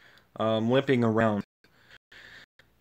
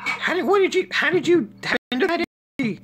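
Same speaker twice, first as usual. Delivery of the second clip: much higher, rushed and amazed; high amazed Krillin; faster than usual. Retold or imitated. Krillin asking Tien how he tapped that energy, high and quick. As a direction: squeak it out fast in awe.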